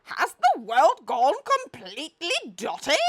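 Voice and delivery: big booming bellow, high and loud. A narrator's reading. The Duke bellows at the strange visitors. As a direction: roar it out in outrage. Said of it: "he bellowed".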